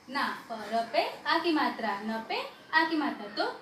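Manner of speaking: usual speaking voice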